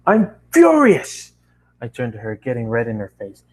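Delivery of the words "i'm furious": high, loud, slow furious outburst. Acted. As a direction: let the fury explode, drawn out. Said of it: "my eyes were filled with rage".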